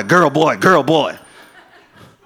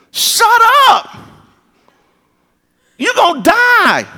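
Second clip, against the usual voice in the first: high, loud shout, slowed at the end; barked command; 4 dB louder, slower than usual. Retold or imitated. Preacher shouting at the kid to sit down. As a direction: snap it at him like a final warning.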